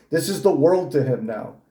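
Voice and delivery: usual speaking voice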